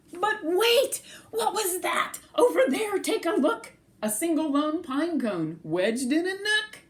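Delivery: high, bright gasp of discovery; excited discovery. A narrator's reading. Reader spotting the pine cone with excitement. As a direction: build the surprise, voice high and eager.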